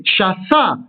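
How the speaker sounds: shafa with the weight on fa; accent the final syllable of shafa; shaFA, ending stressed; stress on last syllable. Shown as a demonstration. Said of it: "se prononceront de la même manière".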